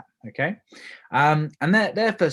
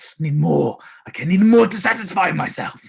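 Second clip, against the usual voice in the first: louder, higher, mock greedy voice; craving more; 4 semitones higher, 6 dB louder than usual. Hooked customer after the sample, mock greedy and loud.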